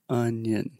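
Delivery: "Onion" with very relaxed uh vowel; relaxed schwa. Shown as a demonstration. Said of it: "relax your mouth even more".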